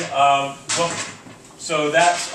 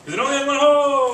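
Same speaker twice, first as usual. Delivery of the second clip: excited shout; 8 semitones higher, 3 dB louder, faster than usual. Real, not acted. Answering student, excited shout.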